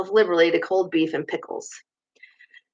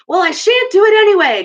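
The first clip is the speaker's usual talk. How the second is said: loud, much higher outburst; excited cry; 12 semitones higher, 7 dB louder than usual. A narrator's reading. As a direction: burst out, worked up and defiant. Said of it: "cried Toad, getting excited".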